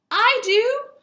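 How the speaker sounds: high, loud eager shout; excited scream. Acted. Marty shouting to the comet, high and loud. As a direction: blurt it out at full volume. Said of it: "Marty screamed".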